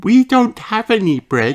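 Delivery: put-on high childlike sheep voice; sweet high young girl voice; little sheep voice.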